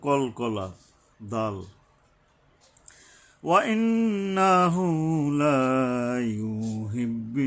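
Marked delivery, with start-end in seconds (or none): 3.4-7.4 slow, drawn-out chanted recitation; Quran recitation. Shown as a demonstration. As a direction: intone each word, stretching the vowels. Teacher recites Quran verse.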